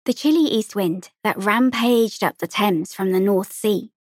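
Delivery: ordinary delivery, nothing marked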